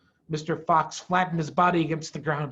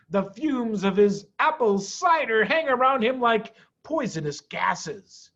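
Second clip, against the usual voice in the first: higher and louder with laughs, mocking the farmers; chuckling Mr Fox; 4 semitones higher, 3 dB louder than usual. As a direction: laugh off the danger.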